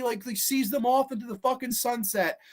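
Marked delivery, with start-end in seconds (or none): none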